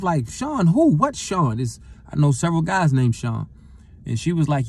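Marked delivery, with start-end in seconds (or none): 0.3-1.6 much higher, urgent confused asking; startled question. Retold or imitated. Hearing from his mother that Sean got shot, alarmed and much higher. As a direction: blurt it out in alarm.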